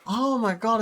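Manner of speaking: ordinary delivery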